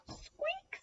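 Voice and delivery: very high, soft squeak; mouse squeak. A narrator's reading. Mouse interrupts Little Lion, high soft squeak. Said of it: "said Mouse".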